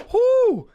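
high, loud whoop of emotion; emotional "whew". Real, not acted. Preacher moved by Paul's story, high emotional exhale. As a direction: let out a high, overcome "whew". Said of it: "That makes me emotional".

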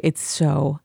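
soft, slow, hushed Zen calm. Real, not acted. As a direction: speak softly, as if lulling someone to sleep. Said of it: "I was talking in my Zen voice".

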